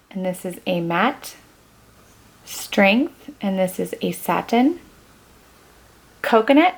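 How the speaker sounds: normal talking voice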